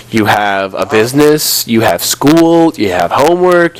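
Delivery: ordinary talk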